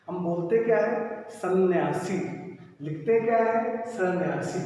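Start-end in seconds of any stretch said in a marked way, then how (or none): none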